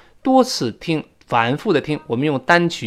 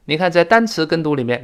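ordinary delivery

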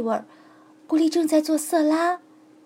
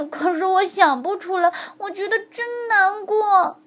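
tearful; 6 semitones higher than usual. A narrator's reading. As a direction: choke up in sorrow. Bone despairs. (骨头带着哭腔难过地说)

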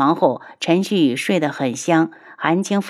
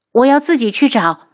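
stubborn refusal; 6 semitones higher, 4 dB louder than usual. Acted.

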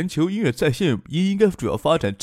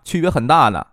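usual speaking voice

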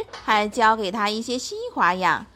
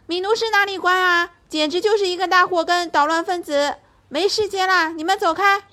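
angry; 9 semitones higher, 4 dB louder than usual. Acted. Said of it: "杂技演员们怒气冲冲地回答".

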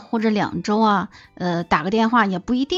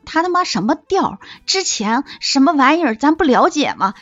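high-pitched indignant griping; bitter venting; 6 semitones higher than usual. Retold or imitated. Pouring out grievances to male best friend, indignant. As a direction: gripe crossly with pitch raised. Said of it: "给他倒了一晚上苦水".

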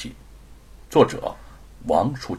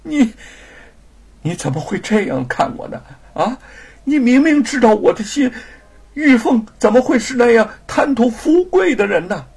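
high, loud wounded protest; pained; 12 semitones higher, 6 dB louder than usual. Acted. Wife protests husband's false accusation, wounded and loud. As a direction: protest through heartache. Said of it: "玉凤心中大痛".

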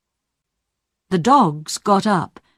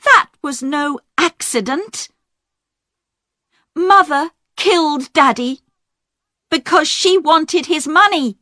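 voiced daughter, agitated; 10 semitones higher than usual. Diane accusing her mother.

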